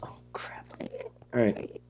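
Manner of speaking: hushed whisper. Real, not acted. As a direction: whisper so it can't hear. Hiding from the dragon.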